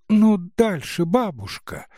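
высокий нетерпеливый детский голос; звонко, как маленькая девочка; голос девочки. Acted. Девочка просит старуху рассказывать. (нетерпеливая девочка)

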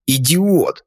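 sharp exasperated shout of idiot; angry outburst. A narrator's reading. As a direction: spit it out in disgust. Narrator curses the neighbor aloud.

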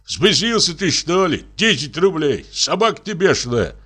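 high, indignant reproach; indignant coachman. Acted. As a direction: scold him for his greed. Coachman outraged at the price.

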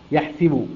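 يحسب with an i vowel on the س; kasra on the sin. Shown as a demonstration. Teacher showing Qalun's reading, يحسب with kasra on س. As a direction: say يحسب as "yahsibu", i after س. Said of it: "حيث يقرأ بكسر السين".

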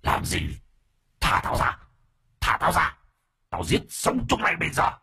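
hissing ghost threat; hissed through teeth. A narrator's reading. Possessed man hisses threats at his captors. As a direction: hiss it, then climb high. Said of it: "Nó rít qua kẽ răng".

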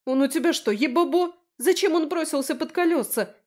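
high, loud indignant squeal; shrieking. A narrator's reading. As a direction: screech at her in hysterics. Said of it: "верещала подруга".

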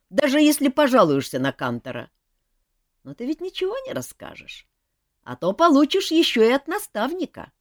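шут Жак. A narrator's reading. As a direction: wave it away lightly. Жак отчитывает принца. (небрежный шут, выше и громче)

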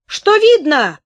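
high, loud hysterical cry; hysterical shout. A narrator's reading. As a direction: scream it hysterically. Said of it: "истерически закричал человек".

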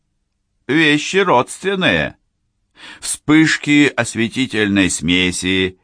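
booming circus ringmaster. Acted. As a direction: boom it out to the crowd like a ringmaster. Showman announces next act to audience.

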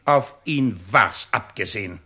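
loud sharp rebuke. Acted. The president loudly corrects the lieutenant's claim, a sharp rebuke.

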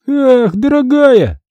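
high, long-drawn sneer; mocking drawl. A narrator's reading. Boy scoffing at the expensive camera, drawling. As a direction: stretch it out high and slow. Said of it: "протянул он".

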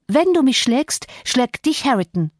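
high-pitched, defiant threat; defiant warning. Acted. Cathy faces down her enraged father-in-law, defiant.

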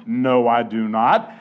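ordinary delivery

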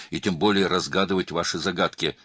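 plain reading voice

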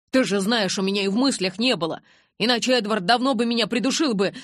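fast, loud, high self-defence; heated, hurried protest; shouting. A narrator's reading. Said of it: "Джейкоб тоже перешёл на крик".

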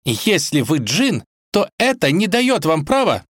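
outraged princess telling someone off; indignant princess. A narrator's reading. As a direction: protest haughtily, voice climbing high. Indignant princess scolds the genie.

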